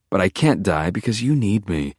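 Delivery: ordinary delivery, nothing marked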